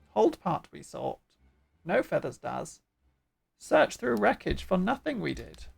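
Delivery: swinging, squawky bird-creature voice. Acted. Bird creatures reporting to their chief, squawky. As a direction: speak as a fussy bird creature. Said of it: "doing the bird voice".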